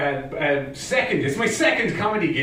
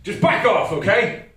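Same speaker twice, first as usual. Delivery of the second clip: higher, firm telling-off; mock exasperation; 6 semitones higher, 3 dB louder than usual. Telling the pushy friend to stop, exasperated. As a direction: draw the line, imitating a fed-up self. Retold snapping at nagging friend.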